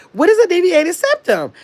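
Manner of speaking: high-pitched retold bafflement; puzzled disbelief. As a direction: act out being thrown by an unknown term. Retells own puzzled reaction to diagnosis.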